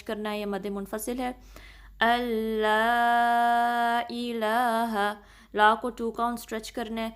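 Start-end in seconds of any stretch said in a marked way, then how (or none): 2.0-5.1 very slow, drawn-out recitation of the greeting. Shown as a demonstration. Teacher reciting the phrase for students to repeat. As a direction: stretch each vowel in a measured chant. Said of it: "لام کو تین چار count stretch".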